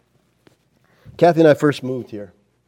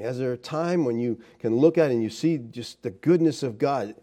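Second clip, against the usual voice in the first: ordinary delivery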